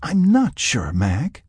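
high-pitched, pleading admission; confessing. A narrator's reading. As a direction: confess your uncertainty. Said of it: "she confessed".

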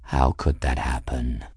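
low, purring-proud cat voice; pleased cat. A narrator's reading. Cat reassures the crying girl, pleased with himself. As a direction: ask it deep and complacent. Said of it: "pleased purring sound in his chest".

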